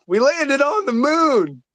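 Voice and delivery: high, slow dopey voice; goofy movie quote. Retold or imitated. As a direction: play the happy dimwit. Quoting a Dumb and Dumber line.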